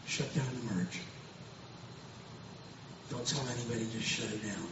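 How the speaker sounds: low, hushed, measured order; grave quiet instruction, lightly voiced; the Lord's command. The Lord instructing the preacher in Puerto Rico, quietly commanding. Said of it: "and the Lord says".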